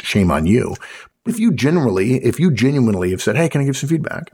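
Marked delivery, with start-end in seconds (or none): 3.4-4.3 light, higher-pitched friendly request; polite tone. Shown as a demonstration. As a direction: ask pleasantly, with nothing loaded. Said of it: "it's a polite tone".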